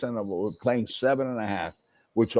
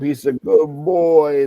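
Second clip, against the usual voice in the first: drawn out, higher and louder; pleading grandfather; 5 semitones higher, 7 dB louder, slower than usual. Retold or imitated. Grandfather vouching for his grandson, pleading. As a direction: stretch it out as a raised-pitch plea. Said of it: "Always was there to defend me".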